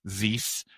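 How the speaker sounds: "zis" with a buzzing Z for TH. Shown as a demonstration. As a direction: say this as "zis", slowly. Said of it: "pronounce T H like Z or S".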